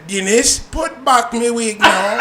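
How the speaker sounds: hammed-up Jamaican accent, slow and loud; mock Jamaican accent. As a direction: imitate a Jamaican woman demanding her wig. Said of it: "And then I said".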